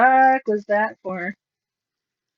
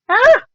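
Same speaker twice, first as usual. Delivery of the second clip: short, high, loud "ah!"; startled yelp; 12 semitones higher, 7 dB louder than usual. Real, not acted. Crafter reacting as the thing rips, startled yelp. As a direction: let out a sudden sharp yelp. Startled cry while working on fabric.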